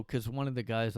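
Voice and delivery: ordinary delivery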